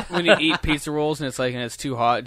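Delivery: ordinary delivery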